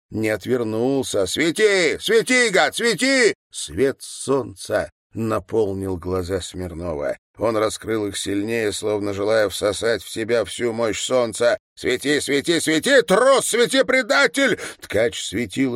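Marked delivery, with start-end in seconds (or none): 1.3-3.3 high, raised cries of challenge; worked-up defiant outcry; defiant shouting. A narrator's reading. Defiant cries into dazzling light. 11.8-14.6 frenzied high-pitched challenge; defiant shouting. A narrator's reading. Defiant chant at the enemy.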